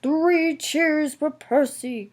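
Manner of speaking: loud, high, slow cheer call. A narrator's reading. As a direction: lead the cheer across a crowd. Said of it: "called the vicar".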